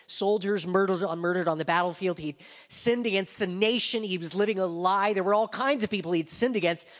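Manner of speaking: loud, high, slowing preacher's call; full-force preaching. Acted. Preacher drives home David's guilt, loud preaching. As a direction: preach it out over the congregation.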